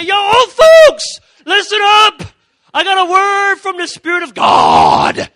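hammed-up high shouting call to the old folks; mock preacher shout. Preacher shouting at nursing home residents, hammed-up yell. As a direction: bellow it like a preacher grabbing the mic. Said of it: "grab the microphone and say".

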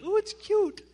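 high, cutesy coo; mock cooing. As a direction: coo like a smitten guy. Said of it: "the guys are like".

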